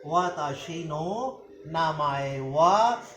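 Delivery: syllable by syllable, very slow; slow Japanese demo. Shown as a demonstration. Teacher to students, the Japanese sentence very slowly. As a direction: lay out each Japanese word slowly. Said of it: "अब हम इसको Japanese में करते हैं".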